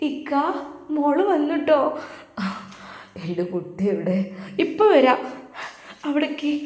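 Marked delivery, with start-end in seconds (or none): 1.0-1.8 put-on high, bright-pitched old mother calling out; a mother thrilled her girl is home; mother's joyful greeting. Mother welcoming her daughter home, high excited call.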